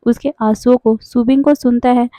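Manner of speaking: ordinary delivery, nothing marked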